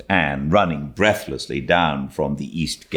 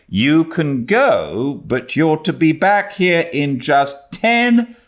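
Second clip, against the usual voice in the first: put-on slow, firm warning to a child; strict guardian, slow and emphatic; stern Marilla; 11 semitones higher, 5 dB louder, slower than usual. A narrator's reading. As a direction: lay down the rule deliberately. Marilla giving strict permission.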